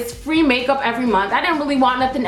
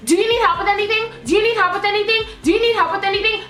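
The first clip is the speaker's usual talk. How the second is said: higher, fast, chirpy sales voice; mock pushy clerk; 7 semitones higher, faster than usual. Clerk hovering over her while shopping, fast high chirpy voice. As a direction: parody an overeager clerk. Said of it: "six people came up to him".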